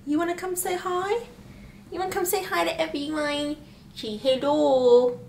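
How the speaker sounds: high, soft coaxing voice. Real, not acted. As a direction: coax a little one to greet the viewers. Talking to baby on camera.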